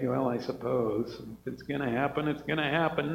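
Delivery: low and soft, listless resignation; half-hearted mock resignation; resigned shrug. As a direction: shrug it off with no hope. Mocking resigned expectancy.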